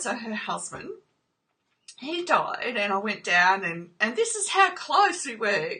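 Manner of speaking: ordinary talk, nothing marked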